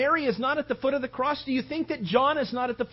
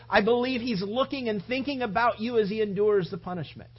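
normal talking voice

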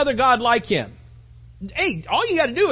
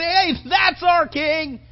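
proud, high cheering shout, drawn out; acted crowd shout, loud and slow; roar of approval; 6 semitones higher, 4 dB louder, much slower than usual. The nation hails its new king, roaring with approval. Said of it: "roars with approval".

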